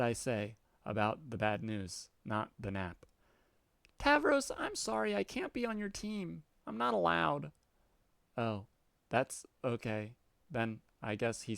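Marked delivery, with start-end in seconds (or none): 4.0-7.5 put-on much higher, childish defiant cat girl. Cat girl refusing the troll's order, high and childish. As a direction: squeak back like a defiant kitten.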